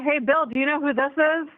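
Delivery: usual speaking voice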